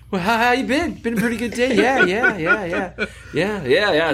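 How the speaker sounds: mock friendly greeting at the office; mock chummy small talk. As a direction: play the breezy chit-chat with the prosecutor.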